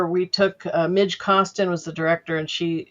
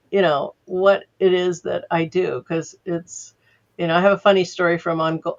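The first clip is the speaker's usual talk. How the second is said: ordinary talk, nothing marked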